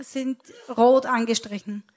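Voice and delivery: ordinary talk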